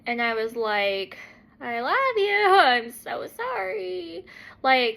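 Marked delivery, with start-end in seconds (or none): 1.6-4.2 high, slow, drawn-out apology; apologetic wheedling. Retold or imitated. Wife apologizing for husband's lost workday, high pleading. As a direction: whine the apology sweetly, pitched up.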